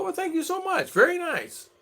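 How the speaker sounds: lifting the voice way up to sound pleased; high sweet thanks. Retold or imitated. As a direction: gush it up high like a delighted lady. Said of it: "I go".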